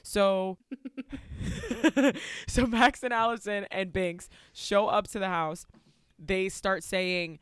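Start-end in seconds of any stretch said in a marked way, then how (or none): 0.6-2.4 high, short giggle; laughing. Real, not acted. Podcast host breaks off mid-recap, giggling. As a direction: let out a giggle.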